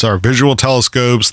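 normal talking voice

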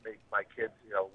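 ordinary talk, nothing marked

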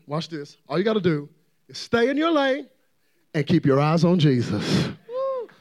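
usual speaking voice

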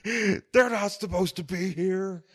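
high, slow announcer wail; mock announcer. Friend imitates a stunned wrestling commentator. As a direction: stretch it out like a shocked commentator.

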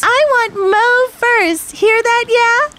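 high, slow gloating cry; gloating villain threat. Acted. Villain picking Mo as first victim, high gloating call. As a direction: call it out high, savouring every word.

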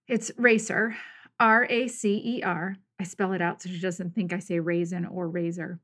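ordinary talk

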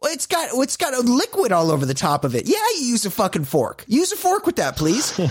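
put-on high, fast, fed-up scolding of the girl on screen; exasperated mock retort. Viewer scolding a TV date, high and exasperated. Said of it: "And I'm like".

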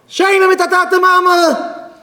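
very high, drawn-out exclamation; acted outrage at the top of the range; outraged cry. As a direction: throw it back as an indignant high-pitched cry. Acted outraged retort about being ashamed.

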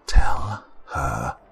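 slow, whispery command; sinister inner voice. A narrator's reading. The voice interrupting with orders to Daryl, whispery. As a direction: hiss the broken order under the breath. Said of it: "the voice interrupted".

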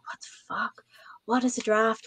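whispered in a panic under her breath; retelling her own scared inner voice as a whisper; panicked whisper. Retold or imitated. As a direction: hiss it like a frightened newcomer. Panicked inner thoughts at the bar.

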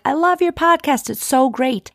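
high, gushing praise; mock gushing fan; gushing listener. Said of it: "so many people say".

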